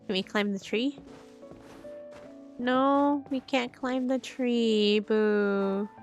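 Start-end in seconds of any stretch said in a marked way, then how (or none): none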